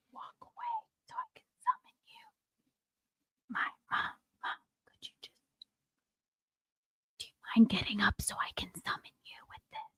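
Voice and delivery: hushed unvoiced whisper. Real, not acted. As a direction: whisper so no one hears. Quietly asking mom to leave.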